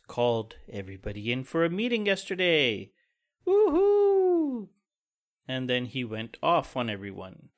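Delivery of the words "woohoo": high mock cheer, dripping sarcasm; sarcastic woohoo. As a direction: fake enthusiasm, squealed high. Sarcastic cheer about boss meeting.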